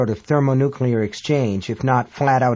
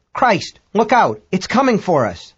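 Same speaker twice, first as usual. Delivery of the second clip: alarmed American astronaut; 6 semitones higher than usual. A narrator's reading. American astronaut warns crew it is coming, high alarmed cry. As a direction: warn the crew in rising panic. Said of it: "American voice".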